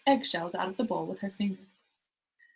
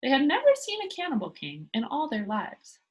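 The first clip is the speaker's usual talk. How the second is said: usual speaking voice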